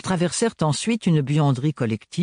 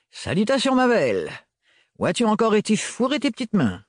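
higher, teasing gallant; flirtatious greeting; 9 semitones higher than usual. A narrator's reading. Renard bows to Gaëlle, teasing higher flirt. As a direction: greet her like a cheeky charmer.

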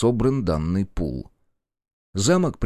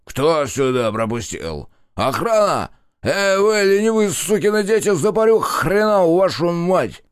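пьяный крик; 9 semitones higher, 5 dB louder than usual. A narrator's reading. Пьяный барон зовёт охрану.

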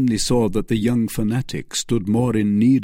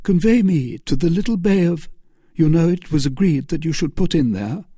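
higher, firm character voice; Felton giving directions; 7 semitones higher, 4 dB louder than usual. Acted. Felton directing the captain to the bay, higher-pitched. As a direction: instruct in a raised pitch. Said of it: "said Felton".

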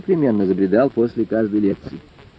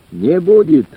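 firm, raised, emphatic refusal; decisive reply; 4 semitones higher, 5 dB louder, slower than usual. A narrator's reading. Lecturer answers the old man's question, decisively. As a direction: answer firmly, leaving no doubt. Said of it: "решительно ответил товарищ Реутов".

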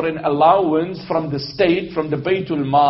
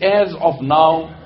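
slow, weighty, spaced-out announcement; slow, firm ruler's declaration; solemn proclamation; 3 dB louder, slower than usual. Acted. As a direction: proclaim the decree word by word. Voices the caliph's proclamation.

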